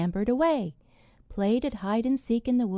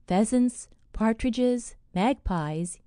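usual speaking voice

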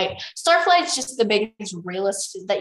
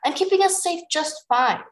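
higher-pitched indignant retort; protesting; 9 semitones higher, 3 dB louder than usual. A narrator's reading. Tsunami defends herself to Starflight, protesting. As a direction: push back defensively in character. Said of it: "Tsunami protested".

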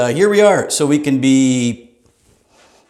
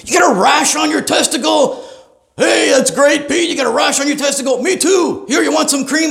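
booming doctor voice; 11 semitones higher than usual. Retold or imitated. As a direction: blurt it out for everyone. Loud doctor answers whispered question.

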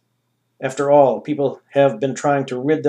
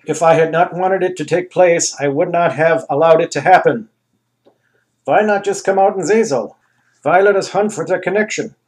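grand ruler; 5 semitones higher, 6 dB louder than usual. Acted. Anus voice held.

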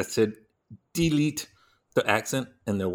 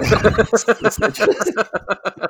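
loud, high cackling laughter; laughing; 11 semitones higher, 8 dB louder than usual. Real, not acted. Reliving a hilarious movie line, loud real laughter. Cannot stop laughing at the quote.

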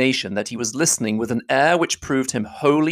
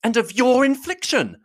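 higher, forceful accusing voice; Elizabeth crying out with energy; 13 semitones higher than usual. Acted. Elizabeth crying out at Darcy, higher and forceful. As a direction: fling the charge at him, higher and forceful. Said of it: "cried Elizabeth with energy".

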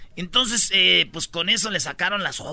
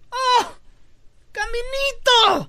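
high, slow cartoon monkey; high stretched cartoon monkey cry; 3 dB louder, much slower than usual. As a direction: squeal "caminito" like a delighted monkey. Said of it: "el chango dice".

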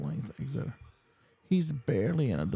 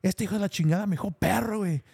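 mock outraged Spanish, higher and fast; hammed-up fuming in Spanish; retold angry rant; 6 semitones higher, faster than usual. Fierrín fuming to the band in Spanish, mock angry. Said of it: "He said".

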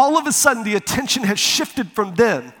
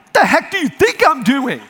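loud, raised, indignant comeback; exasperated retort; 7 semitones higher, 4 dB louder than usual. Retold or imitated. Rescuer answering the screaming brother, loud exasperated retort. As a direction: fire back, louder and higher, fed up.

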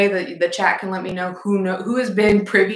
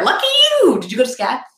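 squealing high and loud; excited; 7 semitones higher, 3 dB louder, faster than usual. Real, not acted. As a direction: gush with delight. Excited reaction to viewer.

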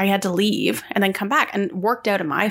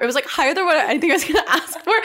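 laughing through the words, pitched higher; amused disbelief; 7 semitones higher, faster than usual. Real, not acted. Recalling an offer higher than expected, amused disbelief. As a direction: let a laugh break in as the voice climbs.

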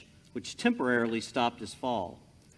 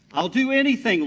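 higher and louder, grasping at hope; desperate eager plea; 9 semitones higher, 8 dB louder, faster than usual. Acted. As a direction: beg without hesitation. Jack begs God.

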